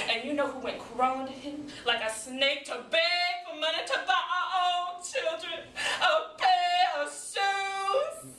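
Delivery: high, hammed-up wife's tirade; melodramatic, aggrieved outburst; fed-up wife, indignant. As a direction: berate your husband, swinging up into wails. Second monologue, wife berating Junior.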